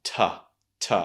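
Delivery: "t" as a short tuh; two separate light "t" syllables, tuh; T plus schwa. Shown as a demonstration. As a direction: isolate "t" with a weak schwa. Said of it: "the T sound and the schwa".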